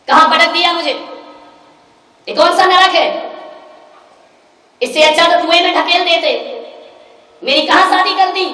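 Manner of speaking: loud, high, indignant wail to her father; a daughter crying out down the phone that she was dumped in hell; shrill protest at being married off; angry complaint. Retold or imitated.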